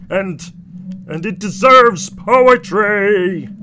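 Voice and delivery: high, drawn-out rapture; Raymond. Acted. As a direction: gush slowly, groping for grand words. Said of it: "Raymond says".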